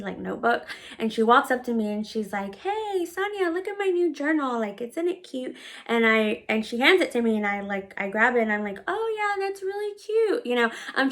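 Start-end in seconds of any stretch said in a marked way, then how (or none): 2.6-5.5 put-on little girl voice. Little girl shows off journal. 8.9-10.5 sweet fake enthusiasm. Humoring the little girl.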